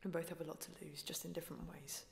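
soft, unvoiced secret whisper; hushed whisper of regret. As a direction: breathe it as a confession. Quiet confession in the boat.

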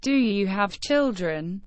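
ordinary talk

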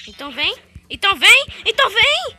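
from calm to loud, high taunting; building taunt. Real, not acted. Gamer taunting an enemy to come closer.

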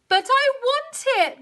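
high, drawn-out whine; high mooing protest; mooing cow. A narrator's reading. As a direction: plead it slowly as a spoilt cow. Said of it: "mooed Cow".

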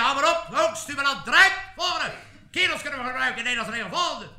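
loud, high military dismissal; impatient army doctor. Acted. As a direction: cut the recruit off and call out orders. Army doctor rejects recruit's excuses.

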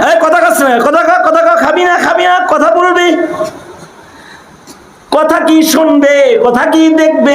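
high, insistent rebuke; scolding the idols. Retold or imitated. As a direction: demand the statues speak. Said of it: "মূর্তির যে ধমক দিতেছে". (মূর্তিকে ধমক, উঁচু গলায়)

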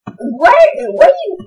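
rising, cracking confusion; baffled. Real, not acted. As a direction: voice shooting up in disbelief. Baffled by piglin trade results.

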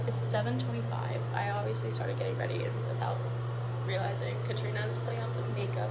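whispering. Real, not acted. Early morning, others asleep.

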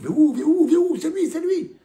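teacher mocking the crowd that points at the sinner; mock accusing crowd. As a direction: point the finger at him like a jeering crowd.

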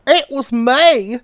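high, slow, loud cricket voice; confused little cricket. Acted. Cricket answering the caterpillar she tripped over, confused. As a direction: stammer it out, confused. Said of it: "quite confused".

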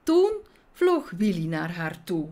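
usual speaking voice

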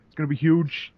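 light Trump catchphrase; a hint of Trump bragging. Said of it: "you punctuate every sentence with".

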